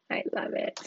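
whispered under her breath; breathy whisper. Real, not acted. Whispering her delight to the viewers. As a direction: hush it out, delighted and close. Laughing delight after story.

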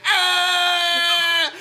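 high, hammed-up scream; mock scream. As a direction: shriek it with a pinched face. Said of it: "sharp pointed face where he's like".

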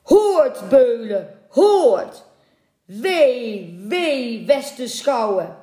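high, loud cry of doom; menacing, drawn-out roar; bellowing. A narrator's reading. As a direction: thunder a threat over the waves. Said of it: "Zijn stem bulderde over de golven".

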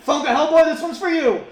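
high, loud hollering; rally the crowd like a hammed-up hype man; big excited shout; mock crowd hype. Hyping an imaginary crowd.

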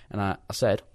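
ordinary delivery

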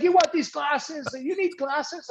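high-pitched mock taunt, slowing at first; retold angry yelling. Acting out the coach offering his glasses, mock angry. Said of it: "he's yelling at me".

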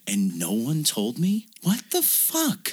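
slow incredulous outrage, climbing high at the end; hammed-up affronted reaction; acted indignation. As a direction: stretch it out in mock disbelief. Acting her imagined outraged reaction.

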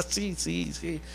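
softly chuckled scoffing agreement; mock laughing. Preacher imitates scoffer dismissing the pastor, soft mock laughing. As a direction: laugh it off, fading away softly. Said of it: "se rió y dijo".

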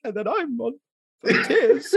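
high, hammed-up teary wail; mock tearful. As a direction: jokingly act out bursting into tears. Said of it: "And I'm like".

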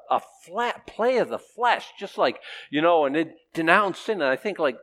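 normal talking voice

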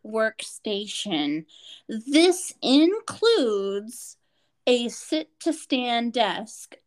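very slow, word-by-word dictation; dictating a form. Retold or imitated. Doctor writing equipment needs on form, slow deliberate dictation. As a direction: spell it out officially, pausing between phrases. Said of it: "equipment do you feel this person needs".

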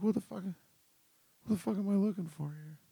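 startled what-the-hell in a noisy crowd; confused outburst. Retold or imitated. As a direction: look around baffled and blurt it out. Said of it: "I'm like".

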